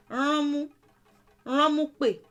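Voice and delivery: Ránmú and ránmúpè said high and loud through a pinched nose; nasal demo. Shown as a demonstration. Teacher modelling the nasal sound for learners. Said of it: "making that nasal sound with the nose".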